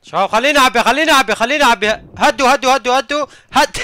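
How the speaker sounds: loud pleading yells; shouting. Real, not acted. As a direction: yell for them to back off. Begging enemies to pause, reloading.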